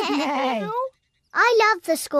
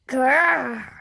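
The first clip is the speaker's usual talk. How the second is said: put-on playful kid's growl; dinosaur growl; 4 semitones lower than usual. [name] plays a dinosaur.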